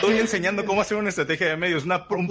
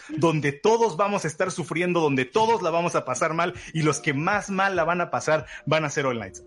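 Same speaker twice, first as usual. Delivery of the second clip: ordinary talk, nothing marked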